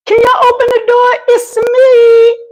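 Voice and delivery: high, drawn-out call through a door. Real, not acted. Locked-out streamer shouting to people inside, pleading high call. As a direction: holler up to be let in.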